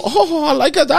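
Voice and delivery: high, loud flirty imitation; high loud imitation of the flirty bartender. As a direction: coo it up high like her. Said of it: "She go".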